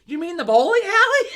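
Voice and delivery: very loud, high incredulous question; retold disbelief. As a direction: blurt it out, about to crack up. Retelling her reaction to mom's mistake.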